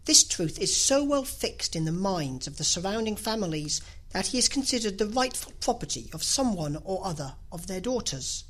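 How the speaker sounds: usual speaking voice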